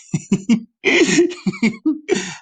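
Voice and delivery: high mock giggle. New Yorkers amused by a Southerner, high giggle. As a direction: giggle like a tickled onlooker.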